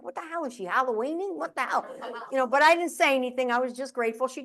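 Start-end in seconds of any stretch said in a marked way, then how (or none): 0.0-1.9 softer, higher mock disbelief; hushed comic bewilderment; baffled aside. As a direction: mutter it as a private, baffled thought. Her muted private reaction, retold.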